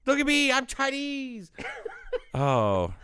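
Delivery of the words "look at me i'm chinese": very high, put-on caricature voice; Dave Chappelle bit. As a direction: squeal it as a mocking comedy character. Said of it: "It's Dave Chappelle in his goofy".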